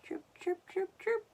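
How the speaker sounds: very high chirping; high robin chirps; bird chirps. Acted. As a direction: squeak like a little robin. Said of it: "chirped the robin".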